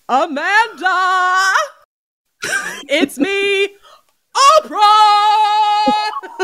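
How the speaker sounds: high, drawn-out sing-song Oprah; Oprah impression. Asked to replay the rat's voice as Oprah. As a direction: croon it like a talk show host. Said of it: "If I did it like Oprah".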